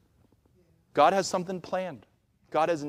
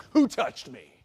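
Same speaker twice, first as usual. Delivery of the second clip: hammed-up indignant surprise; mock startled; 3 semitones lower, 4 dB louder, slower than usual. Someone brushed in a crowd, mock startled. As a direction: play at being jolted by a touch.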